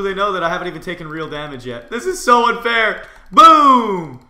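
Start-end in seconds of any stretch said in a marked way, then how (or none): 1.9-4.3 high, loud crowing yell; loud mid-fight holler, stretching BOOM; excited shout. Real, not acted. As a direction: shout it while landing the hit. Crowing while landing hits in fight.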